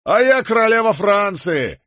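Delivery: high, jeering heckle; mocking shout. A narrator's reading. Joker in the crowd heckling the condemned man, mocking shout. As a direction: call it out derisively, pitched right up. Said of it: "издевательски крикнул какой-то остряк".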